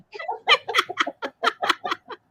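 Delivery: high helpless laugh; real high-pitched laugh. Real, not acted. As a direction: burst out laughing. Laughs at singing threat.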